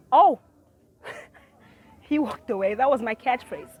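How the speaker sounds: normal talking voice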